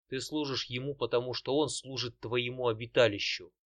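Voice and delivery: usual speaking voice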